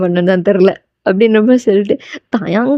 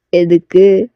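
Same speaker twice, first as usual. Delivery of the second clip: voiced male lead; 4 semitones lower, much slower than usual. Taeyang's low question.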